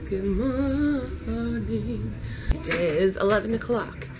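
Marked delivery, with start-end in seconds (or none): 0.1-2.1 stretched, very slow good morning; very slow drawn-out greeting. Acted. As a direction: drag every sound out slowly. Sing-song morning greeting.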